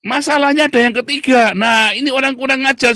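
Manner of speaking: high, loud, ringing call to the congregation; loud preaching shout. Real, not acted. Preacher shouting the third point to the congregation, loud.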